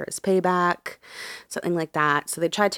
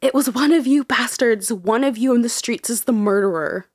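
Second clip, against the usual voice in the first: higher, louder retold hysterical scream; 5 semitones higher, 5 dB louder than usual. Grieving mother screams at street onlookers, mild mock yell. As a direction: yell the accusation at the crowd, mildly acted. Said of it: "Edith screamed hysterically at onlookers".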